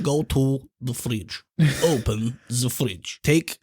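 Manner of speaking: slow, deliberate recipe steps; slow cooking show host; mock slow instructions. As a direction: spell out each step very slowly, like a patient cooking show. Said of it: "It's, like, so slow".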